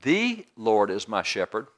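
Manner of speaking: The drawn out and set apart before "Lord is my shepherd"; slow line with a long, emphasized "The"; stress on THE. Shown as a demonstration. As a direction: lean long and hard on the first word, "The". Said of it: "take one word and just emphasize it".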